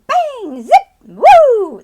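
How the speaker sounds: high loud bang, zip, woo; fun sudden sound effects. Shown as a demonstration. Teacher demoing the kapow reveal with sudden fun noises. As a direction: pop out surprise noises to delight kids. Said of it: "you wanna open it and you wanna woo".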